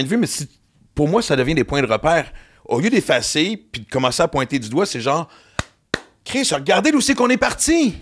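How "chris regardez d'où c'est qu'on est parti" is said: high-pitched, slow, emphatic proclamation; mock-public exclamation, pitched way up; exclaiming proudly. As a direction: cry it out like a rallying speech. Acted proud exclamation of an opinion.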